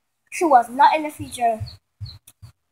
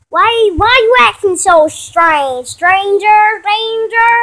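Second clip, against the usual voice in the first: high, loud, slow teasing voice; hammed-up big sister puzzling; Vanessa's excited question; 7 semitones higher, 8 dB louder, slower than usual. Sister teasing Kayla about acting strange, loud and high.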